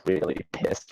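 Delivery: puzzled aside; muttered to himself. Shown as a demonstration. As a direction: mutter the phrase under your breath. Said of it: "What does that even mean?".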